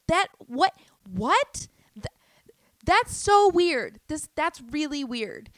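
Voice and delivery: loud, high acted disbelief; sputter, then stretch it out high and loud; incredulous shock. Acts out shocked reaction.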